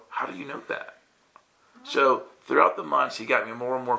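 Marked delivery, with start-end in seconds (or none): 0.1-1.0 breathy, hushed, amazed question; stunned whisper. Retold or imitated. Amazed he asks the woman how she knew, a hushed whisper. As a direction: ask it under your breath in disbelief. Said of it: "and I look at her and go".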